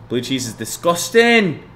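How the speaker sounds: mock disgust. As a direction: stretch it out like you're gagging on it. Reading chat message in mock voice.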